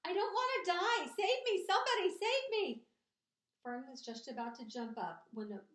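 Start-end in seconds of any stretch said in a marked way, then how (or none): 0.1-2.9 high, loud, panicked cry for help; screamed plea. A narrator's reading. Pig begging anyone to save him, screamed plea. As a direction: scream for your life. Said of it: "screamed Wilbur".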